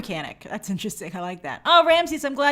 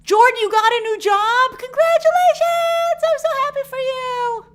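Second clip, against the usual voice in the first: excited congrats; 13 semitones higher, 8 dB louder than usual. Real, not acted. Host cheering a viewer's new job, high excited squeal. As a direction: gush with joy for Jordan.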